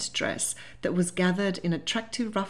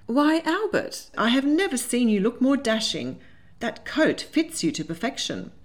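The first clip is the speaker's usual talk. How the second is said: admiring exclamation; 6 semitones higher, 3 dB louder than usual. A narrator's reading. April compliments Albert's coat to change subject, exclaiming. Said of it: "she exclaimed".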